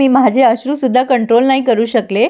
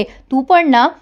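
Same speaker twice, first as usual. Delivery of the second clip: normal talking voice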